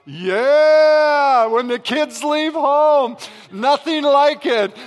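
loud high triumphant cry, slowed; a loud, slow whoop of relief; joyful shout. Real, not acted. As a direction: cheer it out, stretching the words. Parents' joy when kids leave.

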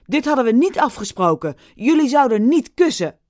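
fast, loud angry scolding; furious. Acted. Furious Steven scolds the actors, fast and loud. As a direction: rattle off the fury. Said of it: "Hij was woedend".

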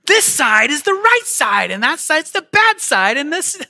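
high, loud mock-stubborn rant; hammed-up righteous insistence; stubborn outburst. Pastor playing himself refusing his wife's view, high and loud. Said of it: "And I'm like".

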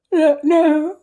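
bored no, yawn barely held back; stifling a yawn. Acted. Hostess bored by guest's ailments, yawning. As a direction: swallow a yawn mid-word. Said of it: "in a voice which was only replaced a yawn".

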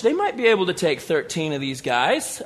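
usual speaking voice

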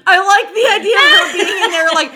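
high voice through real laughter; laughing. Real, not acted. Picturing her in there, talking through laughter.

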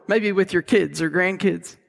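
ordinary talk

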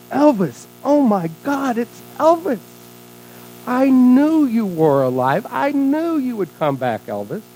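high, drawn-out gushing fan voice; ecstatic fan. Acted. Lady spots her idol at the airport, ecstatic. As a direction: squeal with joy at spotting your idol.

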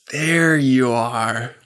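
very slow, drawn-out blessing. Acted. Father's voice finding his son, slow blessing. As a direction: stretch it out as a loving recognition. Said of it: "the voice of blessing".

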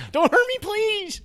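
high falsetto whimpering plea; laughing mock terror; mock panic. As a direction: beg in a hammed-up squeak. Acted robbery panic.

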